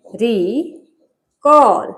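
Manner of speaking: Recall slowly, with a long pause after रि; teacher sounding out recall in two slow pieces; recall syllable by syllable. Shown as a demonstration. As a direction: split recall into two drawn-out parts, रि and कॉल. Teacher splits word into syllables.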